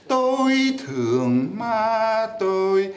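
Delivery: Tôi thương ma tôi slow, tones changed; another tone variant. Shown as a demonstration. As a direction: say it slowly on this tone set. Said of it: "Et si vous dites".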